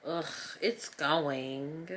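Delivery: drawn-out, very slow dramatic complaint; hammed-up reluctant answer; dramatic groan. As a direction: drag it out with a weary ugh, rising at the end. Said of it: "I'm so dramatic".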